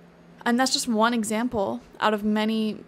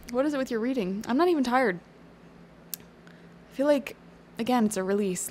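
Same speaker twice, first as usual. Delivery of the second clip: normal talking voice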